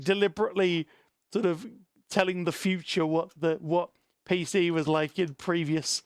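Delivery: normal talking voice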